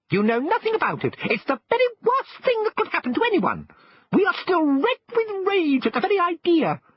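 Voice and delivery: very high, tiny squeaky shrimp voice, indignant; shrimps' voice. Acted. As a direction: squeak it out like offended little shrimps. Shrimps keep speaking.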